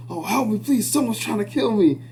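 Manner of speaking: high, frightened-sounding plea; mock panic. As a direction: act the scared man as in a horror film. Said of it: "it just sounded like a horror".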